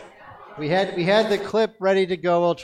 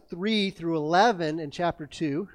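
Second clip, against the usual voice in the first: normal talking voice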